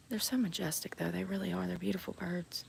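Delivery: awed hushed whisper. Real, not acted. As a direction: whisper it in wonder. Hushed admiration of swans.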